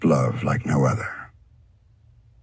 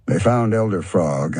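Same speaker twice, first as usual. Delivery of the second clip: plain reading voice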